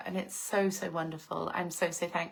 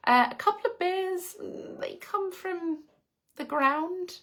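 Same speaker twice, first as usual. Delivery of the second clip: playful tease; 10 semitones higher than usual. Real, not acted. Teasing joke about beer.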